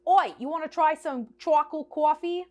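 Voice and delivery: put-on a rough stab at an Australian kid's accent. Imitating the Australian kid offering chocolate coffee, mock Australian accent. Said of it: "That was supposed to be Australian".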